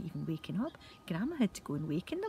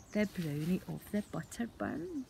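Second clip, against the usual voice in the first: usual speaking voice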